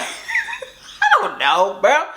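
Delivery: real laugh bursting through the words. Real, not acted. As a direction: laugh it out, high and slow. Laughing at her own naive reply.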